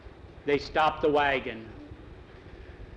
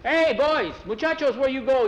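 put-on high, loud call after the boys; 11 semitones higher, 6 dB louder than usual. A narrator's reading. Boss calling after the boys, loud and high. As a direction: shout after them across the yard.

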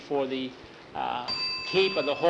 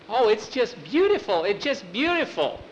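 delighted gasp; 8 semitones higher than usual. Acted. Girl delighted by her room.

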